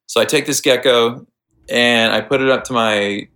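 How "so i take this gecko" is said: normal talking voice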